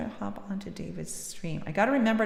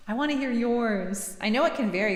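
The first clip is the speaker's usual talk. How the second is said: ordinary talk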